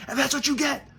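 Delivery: sing-song gloating jab; gloating taunt. Pretend. Taunting the landlord on the phone, gloating high.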